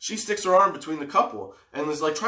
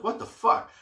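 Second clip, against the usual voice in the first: high mock startled what the fuck; girl hit from behind, voiced higher in shock; startled girlfriend; 10 semitones higher than usual. Girlfriend punched in the head, startled and higher. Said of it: "she's like".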